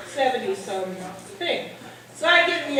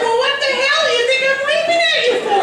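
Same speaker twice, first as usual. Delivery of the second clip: much higher, louder laughing retort; indignant yell; 13 semitones higher, 7 dB louder than usual. Retold or imitated. Wife snapping back at her husband about her waving, loud laughing retort. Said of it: "I said".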